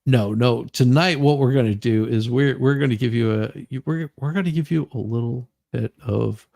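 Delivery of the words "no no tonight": usual speaking voice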